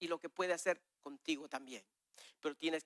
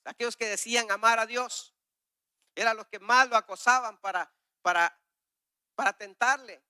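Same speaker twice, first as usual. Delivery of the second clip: louder, higher exhortation; preacher urging the church not to waste time; fervent preaching; 5 semitones higher, 9 dB louder than usual. Real, not acted. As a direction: rouse the congregation. Preaching at full force.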